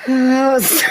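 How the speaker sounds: loud laughing outburst; laughing hard. Real, not acted. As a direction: burst out laughing. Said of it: "You're gonna have to stop".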